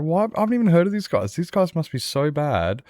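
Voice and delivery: bewildered fan asking why; baffled high voice. Retold or imitated. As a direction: voice shooting up in confusion at an unknown team. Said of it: "and I was just like".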